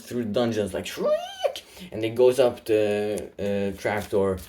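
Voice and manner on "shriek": very high piercing shriek; demon shriek. Acted. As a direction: screech like a monster. Demon shrieking through the dungeons.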